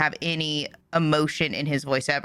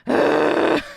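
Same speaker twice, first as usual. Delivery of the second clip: exasperated sigh; 11 semitones higher, 5 dB louder than usual. Real, not acted. Exasperated reaction to show.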